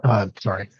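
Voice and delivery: talking around a chip; mouth full. Real, not acted. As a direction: speak with food in mouth. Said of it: "I had put a chip in my mouth".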